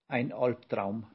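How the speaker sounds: plain reading voice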